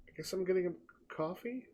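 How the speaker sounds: a waiter muttering to himself offstage; muttered aside. Acted. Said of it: "and you just hear".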